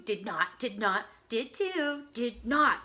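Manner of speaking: put-on loud back-and-forth kid squabble; childish bickering. A narrator's reading. Two sisters arguing over a scare, loud childish retorts.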